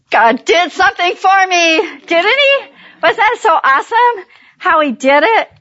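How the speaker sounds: lifted high, bright with real joy; excited praise. Real, not acted. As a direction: let the voice rise in delighted praise. Praising God to the audience.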